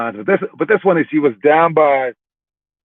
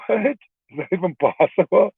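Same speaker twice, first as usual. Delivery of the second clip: higher, slow, incredulous question; drawn-out mock amazement; stunned disbelief; 5 semitones higher than usual. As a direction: retell your own astonishment. Retold reaction of disbelief.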